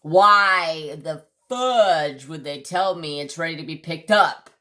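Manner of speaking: loud, drawn-out exasperated question; retold pissed-off demand. Customer asking why they said it was ready, pissed. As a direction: lean slowly and hard on the opening words. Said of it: "I was pissed".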